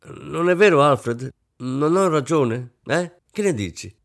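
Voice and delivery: impatient insisting. A narrator's reading. Wife pressing her silent husband to agree, impatient. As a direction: prod him for agreement. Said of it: "insistette con impazienza".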